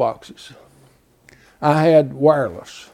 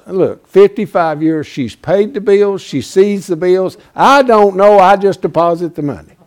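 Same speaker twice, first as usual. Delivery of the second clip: emphatic; 4 semitones higher, 6 dB louder than usual. Real, not acted. Emphatic aside about wife.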